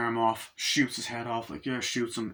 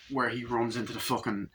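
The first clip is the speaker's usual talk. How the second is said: normal talking voice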